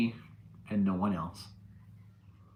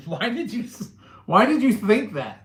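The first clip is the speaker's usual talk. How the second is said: baffled disbelief; 10 semitones higher, 8 dB louder than usual. Real, not acted. Baffled at chat about wife.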